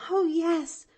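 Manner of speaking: put-on high, loud, eager girl's cry; young girl crying out gladly. A narrator's reading. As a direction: burst out with joy. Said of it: "cried Pollyanna".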